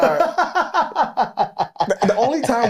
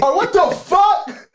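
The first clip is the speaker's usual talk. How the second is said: slow, high, loud shout of disbelief; shocked outburst; 4 dB louder, much slower than usual. Real, not acted. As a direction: yell it out in total shock. Shocked at his own confession.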